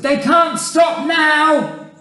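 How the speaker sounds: high, loud insistent order; slow, urgent yell; shouting back. A narrator's reading. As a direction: shout a firm reply down below.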